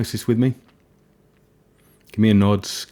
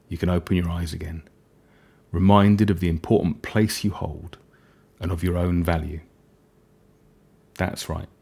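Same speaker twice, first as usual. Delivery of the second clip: hypnotic voice. Acted. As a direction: gently bring the listener back. Ending a hypnosis session.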